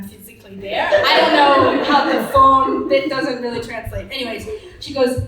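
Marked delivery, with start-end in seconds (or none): none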